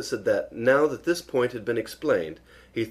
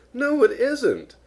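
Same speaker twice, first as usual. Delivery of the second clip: very high, drawn-out little voice; dismayed; 13 semitones higher, 4 dB louder, much slower than usual. A narrator's reading. Piglet seeing the jar isn't full, high dismay.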